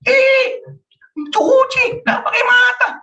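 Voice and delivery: very high-pitched nagging outburst; acted scolding. Spouse scolding the other over a broken favourite plate, high acted anger.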